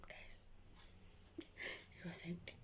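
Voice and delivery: slow hushed whisper. Real, not acted. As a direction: whisper it slowly to the viewers. Dramatic confiding aside.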